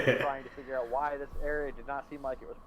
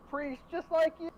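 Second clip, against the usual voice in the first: higher, declared; proud cleric; 14 semitones higher than usual. Acted. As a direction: announce yourself as a fellow priest. Cleric voices his character.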